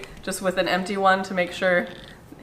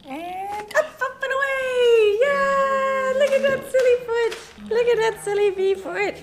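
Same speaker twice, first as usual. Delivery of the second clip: excited cheering. Real, not acted. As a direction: cheer as you lift him up. Lifting baby's foot, cheering.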